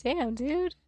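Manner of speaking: high, squeaky mocking repeat of the line. Repeating the streamer's line in a high mocking voice. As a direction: pitch it way up to mock the streamer.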